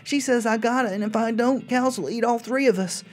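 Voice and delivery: put-on high, childlike diary reading; little girl voice. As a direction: read it like a scared little girl.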